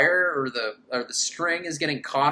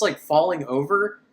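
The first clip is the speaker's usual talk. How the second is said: ordinary talk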